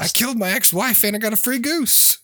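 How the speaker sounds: high-pitched gleeful boast; hammed-up criminal glee; delighted criminal. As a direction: brag like a thrilled dim crook. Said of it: "All these criminals like".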